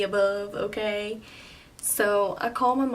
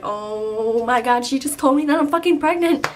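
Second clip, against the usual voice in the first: shocked realization; 3 semitones higher, 5 dB louder than usual. Retold or imitated. Patient realizing the nurse said she's pregnant, acted shock. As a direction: let the news hit, rising into disbelief. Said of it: "it hit me".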